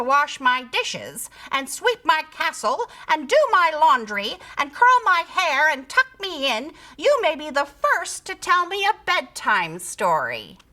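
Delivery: high, commanding tone; bossy creature. A narrator's reading. Creature to her pig servant, bossy. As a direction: order the servant about.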